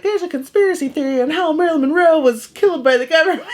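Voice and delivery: put-on high, fast, excited little kid spilling a theory; eager kid voice. Kid telling mom a conspiracy theory, high and fast. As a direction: rattle it off like a know-it-all nine-year-old.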